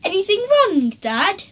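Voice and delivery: high, sugary innocent question; Henry feigning innocence to his dad; sweetest voice. A narrator's reading. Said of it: "in his sweetest voice".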